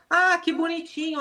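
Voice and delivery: high, cooing praise for a dog; affectionate. Shown as a demonstration. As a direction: coo fondly over a well-behaved puppy. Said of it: "você fala com carinho".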